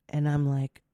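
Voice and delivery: usual speaking voice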